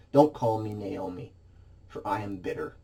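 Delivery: low, quiet, fading bitter refusal; a mild touch of spent, tearless grief; bitter, cried out. Acted. Preacher voicing the grieving Naomi refusing her name, quiet and bitter. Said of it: "Naomi's eyes are red and swollen as she declares".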